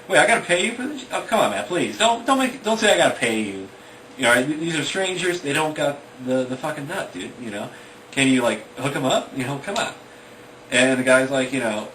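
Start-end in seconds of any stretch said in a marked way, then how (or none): 0.1-9.9 put-on higher, louder pleading child; acted exasperated kid, voice raised; upset little kid. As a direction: protest and beg like an upset kid. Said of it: "upset, like".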